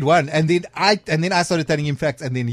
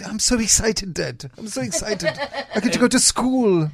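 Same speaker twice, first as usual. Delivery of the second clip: put-on higher voice of a child eager for school; retold childlike excitement, pitched up; excited young son; 8 semitones higher than usual. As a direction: lightly imitate an excited little boy, higher pitched. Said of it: "I, my eldest".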